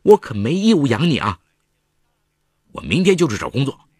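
loud, heated outburst; angry. A narrator's reading. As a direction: snap back in anger. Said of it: "程婉火了".

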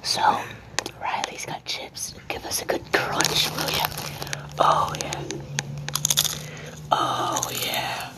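slow ASMR whisper. Pretend. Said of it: "A S M R mukbang".

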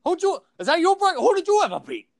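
high, heated trash talk; mock wrestler taunt. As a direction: taunt him like a pro wrestler.

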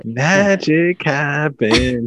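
hammed-up dreamy proclamation; playful mock wonder. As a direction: stretch it out like a magical slogan. Playful slogan after Disney magic remark.

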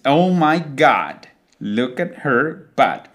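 put-on slow, drawn-out American accent with open vowels; exaggerated American. Teacher mimicking people who want to sound American, exaggerated American accent. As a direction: stretch it like someone imitating an American. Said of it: "wants to sound more American, they like to imitate the phrase".

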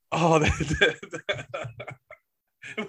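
words broken up by laughter; cracking up. Real, not acted. Host losing it at co-host's story, laughing. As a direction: try to talk through a real laugh.

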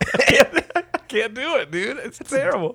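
high, drawn-out words through laughing; helpless laughter. Real, not acted. Podcaster gives up on British accent, laughing helplessly. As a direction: crack up while giving up. Cracks up at own failed accent.

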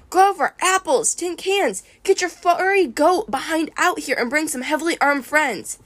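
high, urgent calling; frantic mental summons. A narrator's reading. Captive trying to grab Grover's attention, frantic and high. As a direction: summon him urgently, pitched right up. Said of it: "projected my thoughts like crazy".

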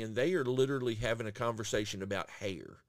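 normal talking voice

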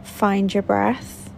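calm, measured meditation guide with long pauses; soothing relaxation-exercise voice, phrase by phrase; guided meditation. Acted. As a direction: unhurriedly guide them through a body scan, leaving space to breathe. Leads a guided body scan meditation.

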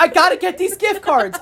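put-on high insistent old lady; a hammed-up high grandma voice; mock grandma. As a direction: squeak it out like a stubborn grandma. Imitating grandma on the phone.

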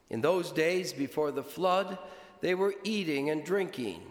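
solemn, measured liturgical reading; gospel reading. Acted. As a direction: proclaim it from the pulpit. Priest proclaims the gospel.

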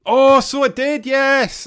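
loud, high cry of recognition; sudden excited recall. Real, not acted. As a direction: burst out as the memory hits, drawn out. Suddenly remembers the game's ending.

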